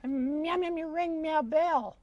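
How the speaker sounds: meowing cat-puppet voice; pussycat puppet. Acted. Cat calling a friend to come, meowing cat voice. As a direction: talk as a little cat, meows between the words.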